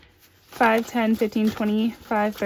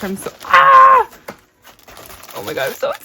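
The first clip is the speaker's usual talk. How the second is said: excited squeal; 9 dB louder than usual. Real, not acted. Squealing at a gift from her friend Janelle, excited squeal. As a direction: squeal like the gift is too good to be true.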